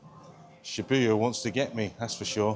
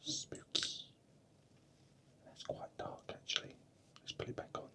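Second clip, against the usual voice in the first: hushed whisper so sleepers aren't woken; 12 semitones higher, 6 dB quieter than usual. Real, not acted. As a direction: keep it to a soft breathy whisper. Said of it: "I'm whispering because people might be asleep".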